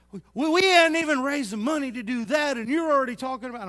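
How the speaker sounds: loud, exasperated mimic of a complainer; indignant, mocking congregant voice; mock complaint. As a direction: throw up your hands at the pastor's big talk. Congregants complaining about his plans.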